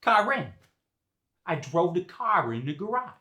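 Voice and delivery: Boston dialect, R kept in "car in"; Boston linking R. Shown as a demonstration. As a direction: link "car in" with an R, Boston style. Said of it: "we keep the R".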